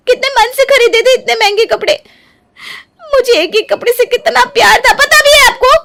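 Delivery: high, loud wailing accusation; shrill accusation. Acted. Neeru accuses Gauri over the clothes, high and upset. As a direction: accuse her, near tears.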